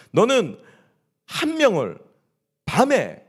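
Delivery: loud, high, broken into separate phrases; pronouncing God. Retold or imitated. Preacher voices God sentencing David through Nathan, emphatically. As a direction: imitate a stern verdict, pausing after each phrase. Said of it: "하나님께서 분명히 명시하시죠".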